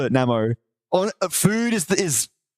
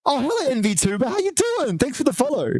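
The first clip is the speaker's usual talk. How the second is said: excited greeting; 8 semitones higher than usual. Real, not acted. Greeting a new follower.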